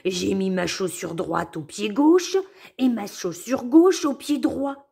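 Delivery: loud surprised outburst; exclaiming. Acted. Wolf exclaims on seeing his shoes swapped, loud surprise. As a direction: realise it all at once. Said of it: "il s'écrie".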